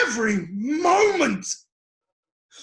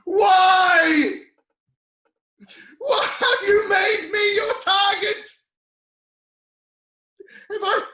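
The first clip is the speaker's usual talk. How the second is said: higher and louder, pleading shout; anguished demand; 6 semitones higher, 4 dB louder than usual. Acted. Job demanding of God why he is targeted, shouting in anguish. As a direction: hurl the question up at heaven.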